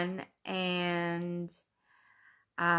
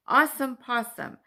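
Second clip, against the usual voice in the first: excited exclamation; 6 semitones higher, 9 dB louder than usual. Real, not acted. Cheerful exclamation.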